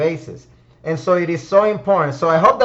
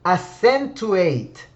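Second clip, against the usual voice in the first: accentuate said very slowly after a long pause, falling at the end; dramatic reveal; much slower than usual. Shown as a demonstration. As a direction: unveil accentuate slowly like a prize. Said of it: "drum roll".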